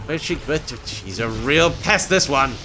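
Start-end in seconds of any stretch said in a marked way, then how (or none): none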